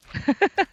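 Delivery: high, loud, quick giggle. Real, not acted. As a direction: let out a short burst of giggles.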